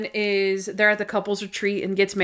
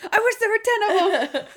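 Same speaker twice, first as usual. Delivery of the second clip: very high, squealed code word; mock freak-out; 13 semitones higher than usual. Randy blurts the code word while being held, imitated high panic. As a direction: imitate a panicked cry, pitched way up. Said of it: "he starts freaking out".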